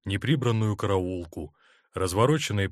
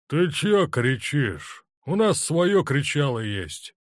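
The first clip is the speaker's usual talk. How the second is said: slow, surly drawl, pitched up; unfriendly lowing grumble; 5 semitones higher, 4 dB louder, slower than usual. A narrator's reading. Belching Cossack talking back to the colonel, surly moo. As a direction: drag it out, sullen and unbothered. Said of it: "недружелюбно промычал".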